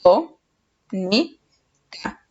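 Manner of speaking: "to, ni, ca" split by pauses, fading on "ca"; syllable by syllable. Shown as a demonstration. Teacher separating the next word for pupils, syllable by syllable. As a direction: say "to... ni... ca", first piece strongest. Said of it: "Separamos en sílabas".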